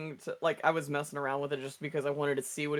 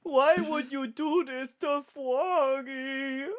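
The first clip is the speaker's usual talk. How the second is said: slow, higher mock-sad whine; mock whiny plea; 7 semitones higher, slower than usual. Friend mourning Froggy the AI, in a hammed-up high whine.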